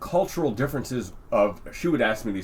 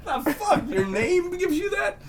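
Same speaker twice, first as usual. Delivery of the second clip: much higher, slow, laughing disbelief; mock disbelief; slower than usual. Imitating his own shock at the free drink, high laughing disbelief. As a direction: squeak out the disbelief through a laugh. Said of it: "I'm like".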